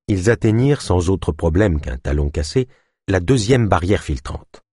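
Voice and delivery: usual speaking voice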